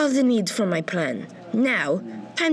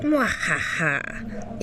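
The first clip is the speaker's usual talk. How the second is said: slow evil villain laugh; 3 semitones lower, much slower than usual. Acted.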